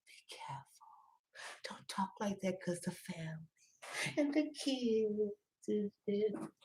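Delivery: slow, hushed, breathless warning; mock whisper. Mocking cautious viewers with a slow hushed whisper. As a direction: hush it like a scandalized gossip.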